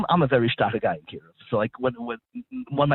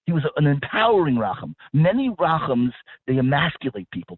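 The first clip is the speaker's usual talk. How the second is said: usual speaking voice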